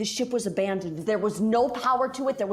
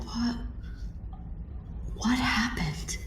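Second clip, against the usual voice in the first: hushed dazed whisper; 2 semitones higher, 7 dB quieter, slower than usual. Acted. Dazed waking, hushed question.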